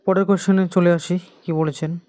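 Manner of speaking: ordinary delivery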